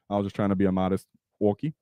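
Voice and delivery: ordinary talk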